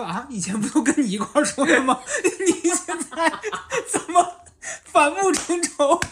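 high, laughing, slowing disbelief; a stunned, giggly why-now; mock bafflement. His baffled inner reply. (心里纳闷：高声、带笑、放慢的难以置信)

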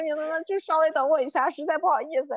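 embarrassed, pleading imitation of an apology; retold apology. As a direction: re-enact begging someone to wait. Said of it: "我就跟道歉".

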